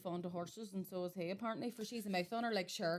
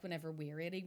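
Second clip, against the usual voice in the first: usual speaking voice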